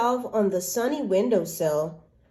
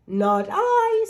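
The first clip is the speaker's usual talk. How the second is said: high cat refusal; 12 semitones higher, 4 dB louder than usual. Acted.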